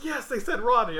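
high-pitched, emphatic imitation; insistent retold reply. Friend confirms the agency mentioned Rodney, imitated insistence.

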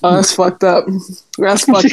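ordinary talk

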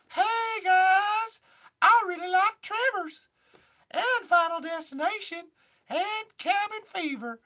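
very high, loud squeaky cartoon voice; high-pitched Edwin. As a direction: stretch it out in a piercing falsetto. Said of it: "high-pitched voice".

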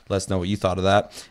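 usual speaking voice

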